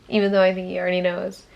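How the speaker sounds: ordinary talk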